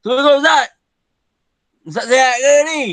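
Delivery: loud cheeky call to the teacher; rude tone. Shown as a demonstration. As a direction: greet the teacher rudely and carelessly. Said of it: "Kalau bahasa yang kasar".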